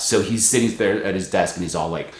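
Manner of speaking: ordinary talk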